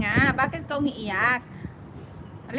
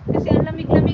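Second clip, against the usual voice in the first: ordinary delivery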